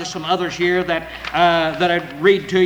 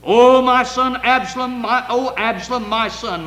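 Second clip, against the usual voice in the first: high, loud lament that fades; crying with a loud voice; 5 semitones higher, 4 dB louder than usual. Acted. The king weeping over Absalom, a loud lament. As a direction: wail it out over a lost son. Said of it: "the king cried with a loud voice".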